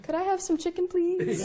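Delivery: high, small, meek voice; sheepish request. Retold or imitated. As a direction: ask timidly, hoping nobody notices you. Sheepish driver asking cooks for food.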